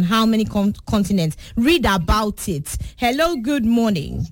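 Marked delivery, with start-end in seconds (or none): none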